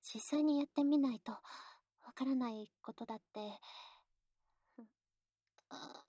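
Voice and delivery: soft, shy intimate whisper. Acted. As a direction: breathe it close to the ear.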